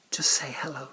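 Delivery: soft coaxing whisper. Acted. Presenter urging the shy puppet to try again, whispering. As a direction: whisper encouragement to a shy friend.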